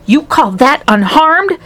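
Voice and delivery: slow, high indignant mother; acted disbelieving snap; snapped. A narrator's reading. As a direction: draw it out in high-pitched outrage. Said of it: "snapped Missus T V".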